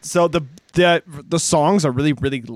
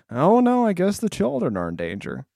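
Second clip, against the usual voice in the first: Gene Wilder unconcerned; 3 semitones higher than usual. Retold or imitated. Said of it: "Gene Wilder's weirdly like".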